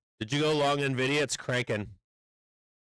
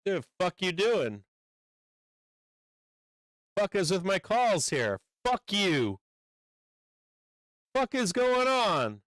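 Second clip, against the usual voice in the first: higher-pitched, incredulous cursing; real outraged protest; angry disbelief; 6 semitones higher than usual. Real, not acted. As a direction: curse out the screen as your money vanishes. Trade suddenly turns against him.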